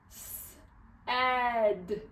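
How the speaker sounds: "S-ed" split after a long hissed S; sounding out. Shown as a demonstration. Teacher models the tricky word, sounding out "S-ed" in parts. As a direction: hold the S, then add "ed". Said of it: "make sure you sound out the word".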